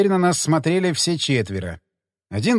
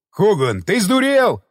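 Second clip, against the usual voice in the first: incredulous yell leaping high at the end; angry yell; 4 semitones higher, 3 dB louder, slower than usual. A narrator's reading. Dick yells at Hogan turning back, angry yell. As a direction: yell as if he's lost his mind. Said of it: "заорал Дик".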